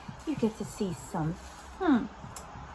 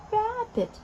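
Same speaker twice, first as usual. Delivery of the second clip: "Rabbit" quicker, pitched high then falling; lively word repeat; 10 semitones higher than usual. Shown as a demonstration. Repeats the taught word more quickly.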